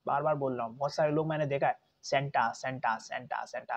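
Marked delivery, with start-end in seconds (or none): 2.0-3.8 "सेंटा" chanted with a hard t and full aa; mistaken Santa. Retold or imitated. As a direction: mimic the learners' repeated, low "सेंटा". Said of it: "अबे सेंटा नहीं है".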